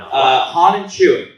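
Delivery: slow, loud drawn-out announcement; loud announcer call. Acted. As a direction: call it out to the crowd. Calling the vote for applause.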